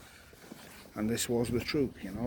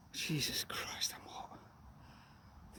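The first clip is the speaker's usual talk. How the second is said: whispered; 3 semitones higher, 13 dB quieter, much slower than usual. Real, not acted. Overheated aside while walking.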